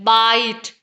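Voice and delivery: बाइट with a long drawn-out आइ; long vowel. Shown as a demonstration. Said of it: "उसका long sound है". (बाइट में लंबा स्वर खींचकर)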